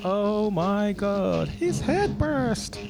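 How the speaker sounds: high, very slow drawl as the Cat; Cat impression. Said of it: "that was my cat impression".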